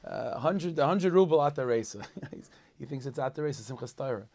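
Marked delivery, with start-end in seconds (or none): 0.0-2.0 put-on loud, high, drunken shouting of the bid; drunken yelling. Drunk man yelling a Simchas Torah bid during Kol Nidre, loud. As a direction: bellow it out like a confused drunk in shul. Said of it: "he yells out screaming".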